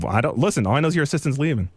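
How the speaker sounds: ordinary talk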